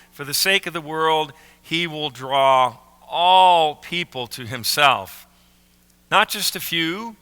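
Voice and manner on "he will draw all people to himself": "all" stretched out and loud; slow line swelling on a long "all"; stress on "all". Shown as a demonstration. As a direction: hit "all" hard and hold it. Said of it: "emphasize the word all".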